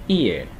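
"Ear" short and low, i gliding straight into ơ; correct joined glide. Shown as a demonstration. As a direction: say "Ear" as one quick joined syllable. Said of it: "chữ y này đọc nửa chữ y thôi". (đọc "Ear" gọn, dính liền i và ơ)

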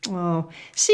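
normal talking voice

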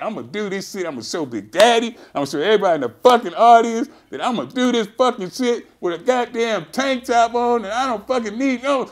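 high, loud and fast pump-up rant; psyched-up boast. Retold or imitated. Psyching himself up to lift in front of everybody, loud and fast. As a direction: hype yourself up before the big lift. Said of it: "I'm like".

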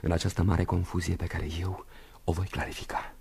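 soft whispered musing; uncertain, whispered to himself. Acted. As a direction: drift into a whisper.

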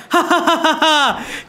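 high mocking villain cackle; triumphant evil laugh. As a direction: laugh in their faces as you flee. Villain reveals himself and escapes.

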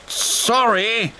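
high-pitched apology; flustered diesel. A narrator's reading. Diesel in trouble apologises to rescuer, flustered. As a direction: apologise in a high, bothered engine voice.

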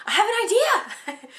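very high, eager announcement; light up with a sudden plan; sudden idea. Retold or imitated. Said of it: "'cause I was like".

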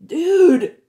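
loud, high offended surfer cry; indignant surfer. A narrator's reading. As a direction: yell it like a knocked-off surfer. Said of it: "with an indignant".

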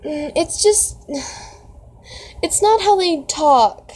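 high, halting protest; overwhelmed, breaking off mid-sentence; flustered. Real, not acted. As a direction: stumble through it in a high, flustered voice. Overwhelmed reaction to the story.

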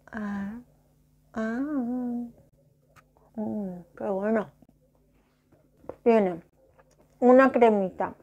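talking through a mouthful of biscuit; words mumbled round a bite; chewing while talking. Real, not acted. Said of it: "No me puede hablar con la boca llena". (hablando con la boca llena)

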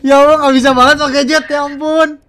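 loud frustrated outburst; panicking gamer. Real, not acted. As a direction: yell it in exasperation. Frustrated after dying.

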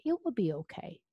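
ordinary delivery

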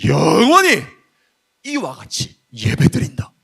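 declaimed in big, separated phrases; old preacher's oratory. Acted. Youth preacher imitates the pastor announcing the answer, grandly declaimed. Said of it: "정답을 얘기하는 거야".

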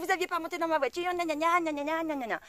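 high nagging mock voice into gna gna; mocking whiny woman. Mimicking the woman whining about her car. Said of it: "Elle me dit".

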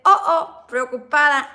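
loud, drawn-out mock worry; reading the emoji out. Reading her diary emoji aloud to viewers, mock worried. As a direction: ham up the worried face. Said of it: "Entonces dice".

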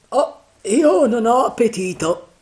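high, loud complaining character voice; grumbling pirate. A narrator's reading. Morgan saying he has lost his appetite, loud grumble. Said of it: "borbottò Morgan".

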